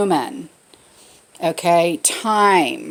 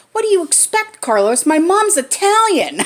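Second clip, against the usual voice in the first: son's proud answer; 9 semitones higher, 4 dB louder than usual. Retold or imitated. Son answers friend, retold.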